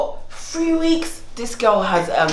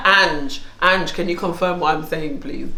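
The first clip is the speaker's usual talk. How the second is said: composed, lower professional voice; 4 semitones lower than usual. Pretend. Asking Ange to back her up, professional voice. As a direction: address her in an even, businesslike tone. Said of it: "She's a professional".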